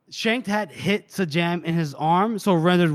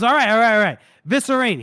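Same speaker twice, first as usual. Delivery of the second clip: higher, louder mock capitulation; hasty surrender; 5 semitones higher, 4 dB louder, faster than usual. Wounded captive giving up the goods, acted panicky surrender. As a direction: give in quickly, as if in pain. Acts victim giving in under pain.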